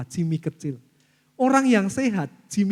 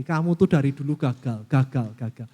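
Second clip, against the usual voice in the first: lower, muttered self put-down; self-condemning voice; 6 semitones lower than usual. Acted. Inner self telling oneself they always failed, low self-condemning. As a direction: drag yourself down, repeating gagal.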